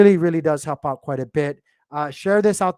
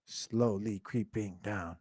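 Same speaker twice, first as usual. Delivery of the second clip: drawn-out, softer murmur; slow and quiet; 6 semitones lower, 10 dB quieter, much slower than usual. Real, not acted. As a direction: let the words sink slowly as the price falls. Watching the token price chart drop.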